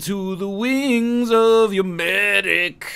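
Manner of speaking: slow, drawn-out mock epic; slow dramatic. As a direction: draw it out grandly.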